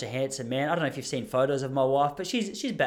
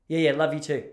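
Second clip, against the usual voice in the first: dismissive. Retold or imitated. Wife answering her affectionate husband, coldly offhand. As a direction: toss it away as if barely listening. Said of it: "but tone of voice says".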